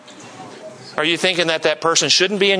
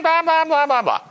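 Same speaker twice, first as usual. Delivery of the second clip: mock nagging; 12 semitones higher than usual. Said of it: "matriarch of the church ... goes".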